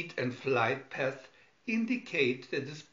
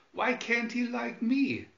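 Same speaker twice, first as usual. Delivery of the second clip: muttering; 10 semitones higher, 3 dB louder than usual. Acted. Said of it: "muttered the robot".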